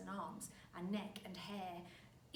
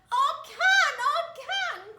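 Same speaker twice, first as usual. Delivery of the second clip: high, loud, slow shrill wife voice; crying out as Mrs Twit; 20 dB louder, slower than usual. A narrator's reading. Wife crying out while balloons stretch her. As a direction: wail it up high, slowly. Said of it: "cried Missus Twit".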